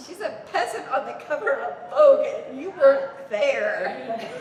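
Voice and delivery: loud, laughing mock protest; mock outraged insistence. Retelling an argument with the boss over a model, loud mock outrage. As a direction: punch it out loudly, dragging out the last words.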